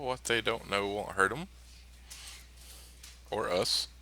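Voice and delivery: usual speaking voice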